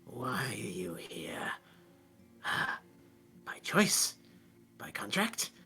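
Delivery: soft, whispered ancient creature, mostly low; huge monster voice. Acted. Aboleth addresses the demon inside its captive, whispery monster voice. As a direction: whisper slowly to the demon, pausing between phrases. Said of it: "this huge voice".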